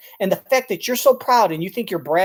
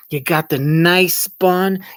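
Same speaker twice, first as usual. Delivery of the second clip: mock savouring; 8 dB louder, much slower than usual. Mocking a proud cook.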